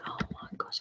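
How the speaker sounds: whispered oh my gosh; nervous whisper. Retold or imitated. Teacher recalling her nerves before the course, whispered. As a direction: breathe it out in a worried whisper. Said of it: "I was like".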